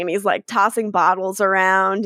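usual speaking voice

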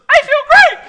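very high, loud talking-toy shout; squealed mock toy catchphrase; Sleep and Snore Ernie. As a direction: blare it like a possessed doll. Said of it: "and he goes".

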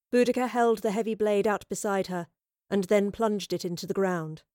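ordinary delivery, nothing marked

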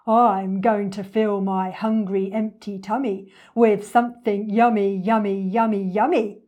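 loud, savouring croc voice; hungry storybook villain, gloating loudly; greedy enormous crocodile, relishing. Acted. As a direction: relish every word of the coming meal.

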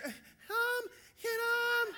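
put-on very high, thin child's pleading. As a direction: squeak it out in a falsetto. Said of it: "my voice is still like [name]".

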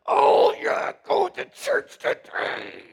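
high, whiny mock sigh of duty; mock someone dragging themselves to church; mock reluctant churchgoer. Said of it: "Like a burden".

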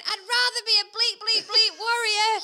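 high, loud shouted comeback; loud imitated runner. Cheerleader imitates a runner calling back to her, loud shout. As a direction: yell it back like a passing runner. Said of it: "this is really loud".

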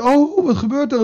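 high, hammed-up startled cry; preacher acting out a scared believer; mock fright. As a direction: play-act being scared. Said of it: "Het eerste wat we gaan doen is bang worden".

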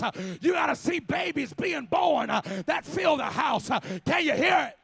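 loud rhythmic preaching with gasped uh; full-force preaching. Acted. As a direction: whoop it up like a revival preacher. Preaching to the congregation.

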